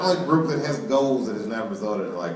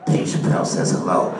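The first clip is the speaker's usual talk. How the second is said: menacing gangster; 7 semitones lower, 3 dB louder than usual. Acted. Gangster delivers the punchline message.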